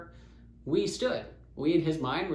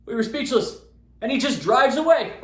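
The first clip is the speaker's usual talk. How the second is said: plain reading voice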